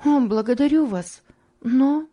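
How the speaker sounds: a touch of anxious pleading; troubled gratitude. A narrator's reading. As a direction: thank her, then falter on a doubt. Eliza thanks her, then breaks off.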